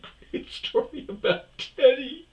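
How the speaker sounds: high, loud laugh through the words. Real, not acted. Comic cracking up over his Teddy story, laughing. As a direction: squeal it out slowly through laughter.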